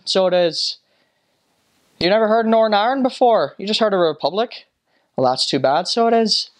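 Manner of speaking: high, lilting Northern Irish voice; chatty put-on Northern Irish brogue; Northern Irish accent. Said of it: "people speak a lot differently".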